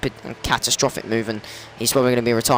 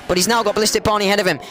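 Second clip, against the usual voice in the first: excited crash call; 9 semitones higher than usual. Real, not acted. Race commentator calling a spin to viewers, high and excited. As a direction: shout it out as Dragon Pro spins.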